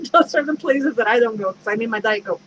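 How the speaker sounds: amused, laugh breaking into the words; real chuckle running into the line. Real, not acted. Joking to listeners about needing Diet Coke, laughing.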